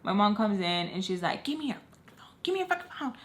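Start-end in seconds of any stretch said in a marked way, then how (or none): 1.4-3.1 high, clipped demand; imitated angry mom. Mother demanding her daughter's phone, high and stern.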